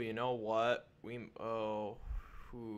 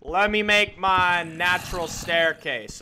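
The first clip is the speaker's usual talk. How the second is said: much higher, loud, slow exasperated yell; yelling back; 9 semitones higher, 16 dB louder than usual. Real, not acted. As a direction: shout back at the noisy mobs. Mobs screaming at him.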